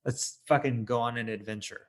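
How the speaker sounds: ordinary delivery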